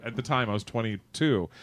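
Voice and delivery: normal talking voice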